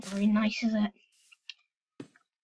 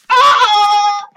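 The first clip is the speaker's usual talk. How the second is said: sudden loud shouted ah; loud yelp; 7 semitones higher, 12 dB louder than usual. Real, not acted. Gamer yelping as an arrow hits him. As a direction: yelp as you get hit. Hit in game.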